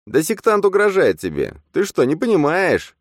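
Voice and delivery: a shrill furious inner double; angry double. A narrator's reading. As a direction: shout at him to wake up. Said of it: "сердито воскликнул двойник".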